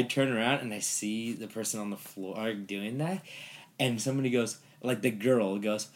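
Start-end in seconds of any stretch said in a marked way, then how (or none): none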